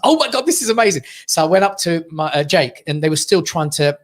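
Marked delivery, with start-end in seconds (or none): 0.0-1.0 higher-pitched delighted exclamation; excited new father. Retold or imitated. New dad sees his first baby, excited. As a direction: gush with amazement.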